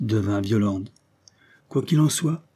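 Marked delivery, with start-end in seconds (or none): 1.7-2.5 a raised call over the din; exclaiming. Acted. Guest calls for silence at the table, exclaiming. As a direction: cut through the table noise. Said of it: "s'écria l'un des convives".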